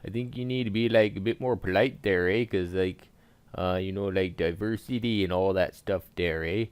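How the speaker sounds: hammed-up Canadian accent; thick comic Canadian accent. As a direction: play it as a folksy Canadian with a sing-song "eh".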